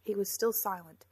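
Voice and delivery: ordinary delivery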